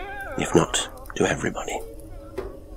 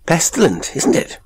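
sudden high-pitched outburst; abrupt exclamation; 11 semitones higher, 3 dB louder than usual. A narrator's reading. Gilday turns from the window to the father, abrupt exclamation. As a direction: burst out with it, pitched well up. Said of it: "exclaimed abruptly".

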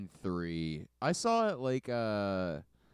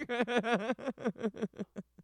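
long, high-pitched laugh; real fit of high giggles; 10 semitones higher than usual. Real, not acted. Laughing at the fake title.

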